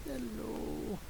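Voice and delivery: high, hushed, drawn-out hello; soft pet greeting. Real, not acted. Greeting Bagel up close, soft coo.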